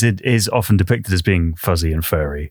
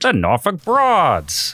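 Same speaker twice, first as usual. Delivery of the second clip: showbiz announcer; 9 semitones higher, much slower than usual. Pretend. Jokey drag act name.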